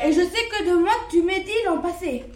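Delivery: loud, accusing; the wolf accuses the lamb loudly; angry wolf. Acted. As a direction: hurl the charge at him.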